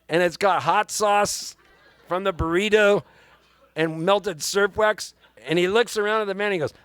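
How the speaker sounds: ordinary delivery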